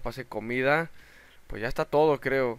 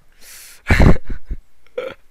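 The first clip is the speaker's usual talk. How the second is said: loud breathy laugh; 13 dB louder than usual. Real, not acted. Gamer laughing loudly at dying. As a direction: burst of laughter at own failure.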